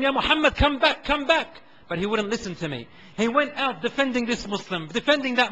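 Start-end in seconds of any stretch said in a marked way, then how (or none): 0.0-1.5 higher urgent call; calls after the boy to come back. Retold or imitated. Calling out in battle.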